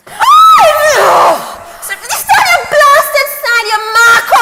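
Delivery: high, loud furious yell; angry shout. Acted. Margaret yells at the driver who cut her off, furious. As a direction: blast the other driver.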